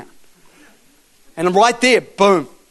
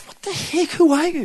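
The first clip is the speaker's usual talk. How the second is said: imitated crying; 11 semitones higher than usual. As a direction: mimic a tearful, bewildered woman. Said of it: "She's crying".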